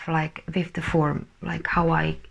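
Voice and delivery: ordinary talk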